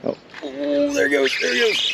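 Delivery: high, thrilled outcry; excited. Real, not acted. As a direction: squeal as the fish takes it. Fish strikes the lure.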